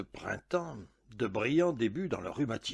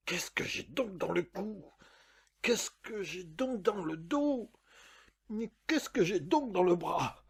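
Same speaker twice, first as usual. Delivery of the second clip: alarmed cry; 5 semitones higher than usual. A narrator's reading. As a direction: wail each question in rising distress. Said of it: "et je m'écrie".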